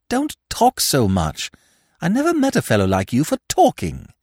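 high-pitched, fretful complaint; exasperated. A narrator's reading. Feverish Freddy complains to Smith.

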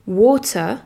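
British water; "water" with a round o, a crisp T and no final R, "woh-tuh"; British English. Shown as a demonstration. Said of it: "à savoir le A". (en anglais britannique, "water" avec un o rond, un T net, sans R final)

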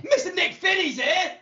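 loud, high hype announcement of a guest; excited welcome. Acted. Host welcoming the mystery guest, loud excited announcement. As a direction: hail the guest with a big laughing cheer.

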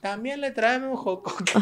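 high-pitched hawker's pitch; street vendor. Pretend. Adding goods to joke sales call, hawking. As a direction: carry on a seller's mock call.

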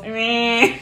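a soft, bored "Meh"; quiet deadpan "Meh"; imitated meh. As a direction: mimic her unimpressed face. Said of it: "She always goes".

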